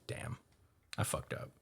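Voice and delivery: very soft, near-whispered aside; hushed regret. Acted. Admiral realizing he went too far, acted hushed regret. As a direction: say it under the breath, as a dawning realization.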